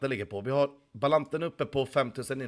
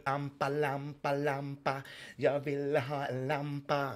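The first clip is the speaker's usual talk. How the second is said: put-on childish voice; 3 semitones higher, 4 dB quieter, much slower than usual. Silly voice asking for lamp.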